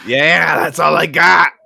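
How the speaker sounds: high, loud Brooklyn debtor; Brooklyn accent. Acted. Debtor answering the collector, hammed-up Brooklyn accent. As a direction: insist hammily in Brooklyn. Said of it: "do the Brooklyn accent".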